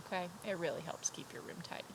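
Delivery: ordinary delivery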